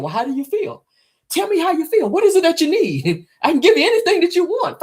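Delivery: high, put-on voice of an eager lover; eager man. Man offering her anything he can give. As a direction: ham up his eagerness to please.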